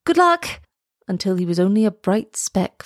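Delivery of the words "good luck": high-pitched cheering call; shouted send-off. A narrator's reading. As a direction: call out good luck from the shore. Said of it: "They waved and shouted".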